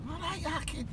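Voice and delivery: high-pitched, soft Scouse impression; mock Liverpool lilt; Liverpool accent. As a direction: greet them like a put-on Scouser. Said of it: "do my Liverpool accent".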